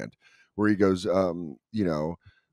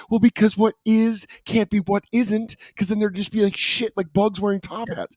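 much higher, mock-frantic nonsense explaining; a dad spinning wild answers in a pitched-up voice; mock rambling dad; 12 semitones higher, 4 dB louder than usual. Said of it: "he does that whole bit where he's like".

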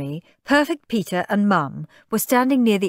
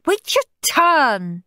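high, stretched bossy yell; high, cross telling-off; shouted order; 10 semitones higher, 3 dB louder, much slower than usual. A narrator's reading. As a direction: shout like a moody girl. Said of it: "shouted Moody Margaret".

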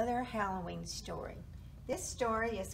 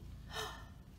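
short, quiet intake of breath; hushed acted gasp; startled gasp; 12 semitones higher, 10 dB quieter than usual. A narrator's reading. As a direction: gasp softly in storybook suspense. Narrator gasps at the grin.

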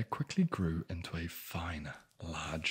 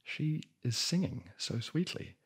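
high, louder disbelief; incredulous; 6 semitones higher, 3 dB louder than usual. Real, not acted. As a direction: climb up in mock outrage. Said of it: "mocking the story's logic".

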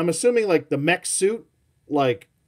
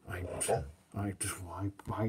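whisper; 7 semitones lower, 14 dB quieter, faster than usual. Real, not acted. Whispering to the excited dog, soothing.

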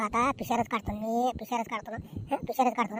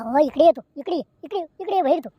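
loud, raised call across the yard; impatient shouting summons; 3 semitones higher, 5 dB louder than usual. Acted. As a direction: yell for her to come out, now. Husband yelling for wife outside.

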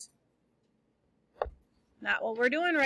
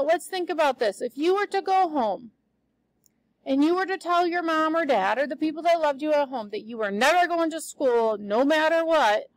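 ordinary talk, nothing marked

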